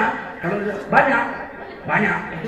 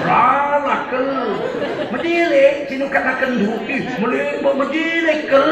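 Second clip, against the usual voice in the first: put-on Karo accent; 5 semitones higher, 4 dB louder than usual. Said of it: "kalau orang Karo pakai ke".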